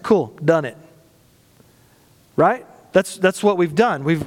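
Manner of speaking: normal talking voice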